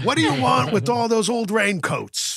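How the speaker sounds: high put-on annoyed voice; annoyed TV character impression. Podcaster quotes Klompus over raincoats, high annoyed imitation.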